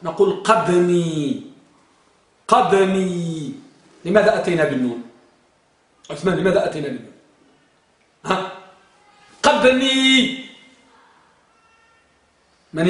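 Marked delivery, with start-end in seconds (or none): 0.4-3.5 "قدمي" drawn very slowly, stopping on the d before a long final ni; held sukun. Shown as a demonstration. Teacher showing students the form, slow drawn-out demonstration. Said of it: "ولذلك نقول". 9.4-10.4 "قدمي" higher and very drawn out as qad-niii; held sukun. Shown as a demonstration. Teacher prompting silent students, raised elongated demonstration. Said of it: "ماذا نقول؟".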